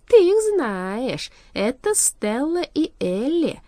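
self-satisfied villain, loud and lingering; smug. A narrator's reading. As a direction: savour the news, drawing it out. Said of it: "усмехнулся с довольным видом".

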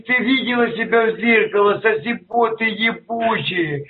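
ordinary delivery

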